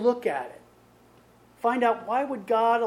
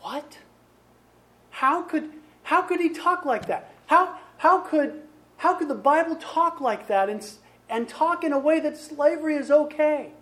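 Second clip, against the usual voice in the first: higher-pitched, disbelieving objection; incredulous, stumbling over the question; shocked reader; 5 semitones higher than usual. Acted. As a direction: act out a reader who can't believe it. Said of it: "some people would read that and go".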